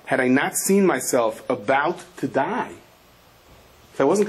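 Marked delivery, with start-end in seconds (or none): 0.0-2.7 slow emphasis. Retold or imitated. Patient stresses what he saw.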